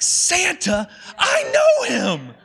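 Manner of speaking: high, loud, slow excited shout; imitating a scream. Pastor imitating Buddy's ecstatic Santa scream. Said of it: "the scene where he screams".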